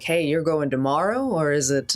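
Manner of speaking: ordinary talk, nothing marked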